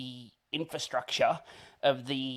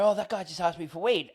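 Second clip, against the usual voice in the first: a higher, toned-down report; milder tattling; 5 semitones higher, faster than usual. Retold or imitated. Teenage waiter tells his manager, mildly indignant report. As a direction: retell the complaint with less alarm.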